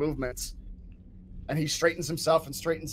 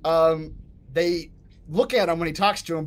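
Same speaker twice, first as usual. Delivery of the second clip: ordinary talk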